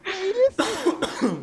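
very slow, high drawn-out what; stunned disbelief. Real, not acted. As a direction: drag it out in shock. Stunned by the instant defuse.

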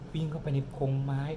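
plain reading voice

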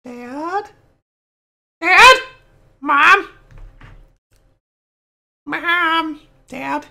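high, questioning call; calling for parents. Pretend. Gamer calling Dad and Mom, high questioning calls.

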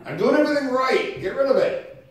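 fed up and pleading at once; frustrated outburst. Retold or imitated. Sick preacher voicing his fed-up prayer, exasperated and loud. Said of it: "And I'm like".

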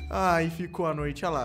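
ordinary delivery

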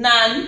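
"none" said as a short nun; the second syllable alone. Shown as a demonstration.

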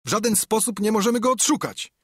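hurried high-pitched shout; shouting back. A narrator's reading. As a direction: call the answer down quickly. Robber shouts answer from upstairs.

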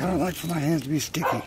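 squeaky, quick pet voice talking for him; high monkey voice. Pretend. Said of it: "He's like".

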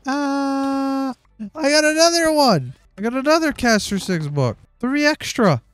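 squealing over a lucky drop; excited. Real, not acted. Streamer thrilled at another Caster six book, excited high yell.

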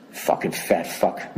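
low, slow, unvoiced curse at the guard; whispered insult to nobody; under-breath curse. Real, not acted. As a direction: mutter it to yourself, slowly. Muttered insult after the story.

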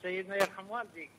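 faint caller on a weak line; bad phone line. Real, not acted. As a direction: speak quietly down a bad line. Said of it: "صوتك غير واضح".

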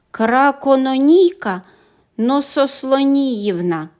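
slow, loud careful syllables; slow name. A narrator's reading. Dragonling calling the tricky name slowly and loud. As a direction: stumble carefully through it.

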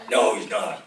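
gruff friend shutting down the car talk; grumpy friend. Retold or imitated. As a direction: bark it back like a fed-up friend. Friend Bob's curt retort voiced.

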